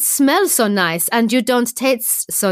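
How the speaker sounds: slow, high, hammed-up French accent. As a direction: play a French lover delicately complaining. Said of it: "I can do a French accent".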